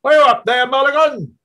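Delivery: loud, high call from inside the tower; calling loudly. Acted. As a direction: shout it up the stairs to someone above. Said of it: "A voice within the tower called loudly".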